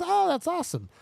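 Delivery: imitated high, pleased reaction; friendly enthusiasm. Imitating a mother hearing the neighborhood, enthusiastic. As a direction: react way up in pitch, mimicking her.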